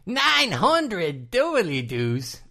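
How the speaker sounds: put-on very slow, high, drawn-out Australian accent; quoting the episode in an overdone Australian accent; mock Australian accent. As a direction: stretch out every word in a cartoon Australian voice. Quoting the show's Australian character.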